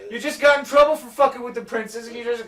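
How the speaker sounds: high, loud disbelieving scolding; shouted exasperation at a reckless friend; incredulous outburst. Retold or imitated. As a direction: yell at him for attacking again. Fossaway scolds Dunk, incredulous.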